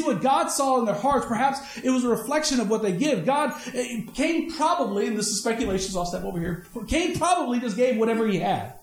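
ordinary delivery

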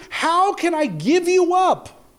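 very high, slow, drawn-out appeal; preacher voicing God's heartfelt cry; impassioned plea. Preacher voices God refusing to let go.

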